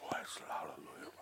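barely whispered, nervous praise; mock-frightened whisper while being watched; soft, breath-only hallelujah; timid worship. Imitating his timid, watched worship.

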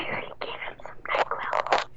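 very soft, voiceless whisper. Acted. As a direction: hush it right down to breath. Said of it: "this is all in whisper".

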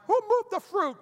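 high-pitched comic grumble; comically indignant, way up high; mock complaint. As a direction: squeak out a petty grievance. Acts out grievance at grocery store.

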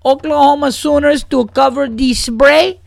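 put-on high Spanish-accented sports bet. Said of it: "I just have fun saying".

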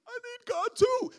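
very high, loud mock wail; hammed-up tearful cry, drawn out high; imitated crying. Lady from across the street joins in, hammed-up crying. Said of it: "She starts crying".

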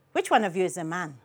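low, quick, soft question; father's quick question. A narrator's reading. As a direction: slip the question in fast and low. Father's intrusive question, first take.

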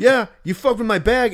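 angry quote. Retold or imitated. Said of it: "He's like".

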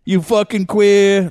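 mock Boston heckle. Boston fan jeering at a friend, mock holler. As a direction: stretch the insult like a heckler.

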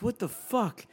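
high, loud stunned exclamation; be utterly bewildered; baffled outburst. Retold or imitated. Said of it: "And I'm like".

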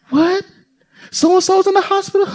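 high, feigned shock; light mock gasp; mock surprise. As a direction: play the news as surprising. Acting shocked then laughing.

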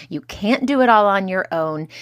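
normal talking voice

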